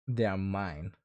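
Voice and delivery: low, quiet possessive claim; a hint of jealous ownership, voice dropped low.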